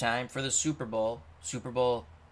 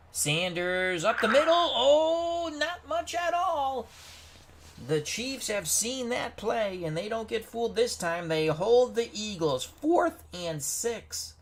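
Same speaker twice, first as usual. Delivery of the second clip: excited play-by-play; 8 semitones higher, 3 dB louder, slower than usual. Real, not acted.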